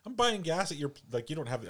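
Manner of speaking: high, rising consumer indignation; indignant retort. Retold or imitated. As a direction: push back high and huffy. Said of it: "getting my back up".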